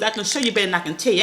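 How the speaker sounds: rattled off fast. Shown as a demonstration. To her viewers, rattling off her motto fast. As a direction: say it quick like you always do. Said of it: "I talk fast".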